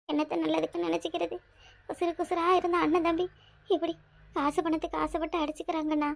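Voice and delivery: soft, heavy-hearted lament; sorrowful. Acted. Mother lamenting her sons fighting over money, sorrowful. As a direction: mourn the family rift under your breath.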